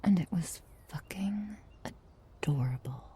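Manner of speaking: soft whisper. Acted. Telling her partner their sleep giggles were adorable, soft whisper. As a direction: let the words fall to a fond whisper.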